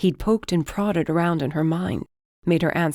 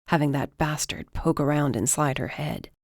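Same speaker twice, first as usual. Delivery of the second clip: ordinary delivery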